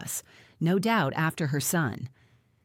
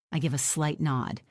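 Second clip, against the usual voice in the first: ordinary delivery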